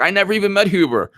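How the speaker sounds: hammed-up innocent denial; mocking Mark Meadows. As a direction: toss it off in a high mocking voice. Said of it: "Mark Meadows is like".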